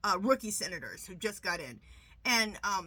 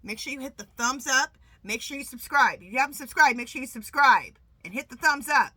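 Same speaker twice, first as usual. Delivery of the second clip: emphatic plea; 3 semitones higher, 9 dB louder than usual. Real, not acted. Pushing viewers to subscribe.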